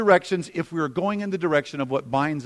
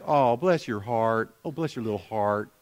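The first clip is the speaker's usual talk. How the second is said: a hint of a syrupy Southern woman; Southern lady; 7 semitones lower than usual. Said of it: "Southern women say".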